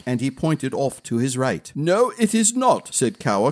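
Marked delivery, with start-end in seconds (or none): 1.8-2.9 high, firm retainer's correction; correcting. A narrator's reading. Retainer setting the gnome straight, high correction. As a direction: correct him, pitched up. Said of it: "correcting him".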